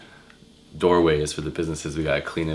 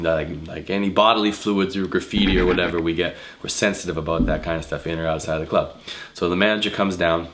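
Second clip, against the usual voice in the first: usual speaking voice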